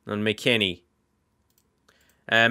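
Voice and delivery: ordinary talk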